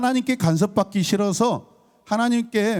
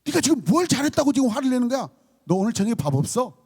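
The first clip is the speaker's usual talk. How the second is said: quick, high scolding, acted out; angry parent; 6 semitones higher, faster than usual. Parent scolding a child who talked back, in mock anger. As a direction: tell off a child who dared get angry. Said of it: "여러분, 화날까요".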